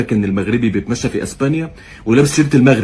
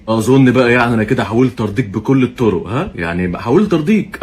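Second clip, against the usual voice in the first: usual speaking voice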